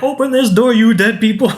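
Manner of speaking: bossy stepmother ordering the dead out; mock Delia yell. Delia yelling at ghosts, quoted.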